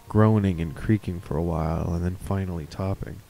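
ordinary delivery

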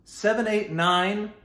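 eager setup. Retold or imitated. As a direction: lead him on, waiting for the answer. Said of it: "and I say".

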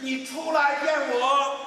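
put-on high, loud, slow child's call; anxious boy. Boy shouts at the unseen echo, high anxious call. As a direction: shout it like a worried kid. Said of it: "他着急了".

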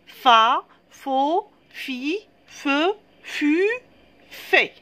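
"fa, faux, fi, feu, fut, fait" as separate sung-out syllables, evenly spaced; reciting syllables. Shown as a demonstration. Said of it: "ça va donner".

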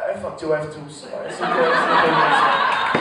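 lightly put-on French accent, laughing through the end; smug French shrug of a voice, breaking into laughter; French retort with a laugh. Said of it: "To which the French say".

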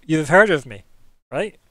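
smug self-introduction. Acted. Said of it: "it's that nod of".

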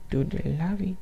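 "lavi" with an ee ending, very soft; playful mispronunciation. Pretend. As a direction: quietly toy with "lavi" for lava. Repeats the twisted word, rising.

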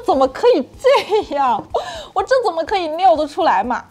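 high, loud, flustered objection; embarrassed protest. Retold or imitated. Speaker retells objecting to an order, embarrassed and flustered. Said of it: "我当时真的脸歘一下就红了".

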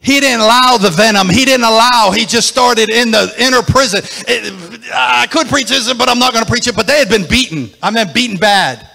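loud, rising preacher's call; whooping sermon climax; preaching at full force. Acted. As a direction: preach it hard over the music.

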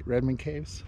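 normal talking voice

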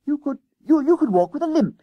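high, loud schoolboy brainwave; sudden decision. A narrator's reading. Jennings hands friend a limp as disguise, triumphant. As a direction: stumble eagerly into the idea, pitched right up. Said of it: "he decided".

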